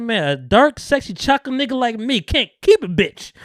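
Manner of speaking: high, loud imitation on the edge of laughter; hammed-up quoted lament. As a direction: deliver the boast-complaint with comic gusto. Performing favourite line of drunk Franklin.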